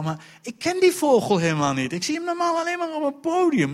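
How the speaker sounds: normal talking voice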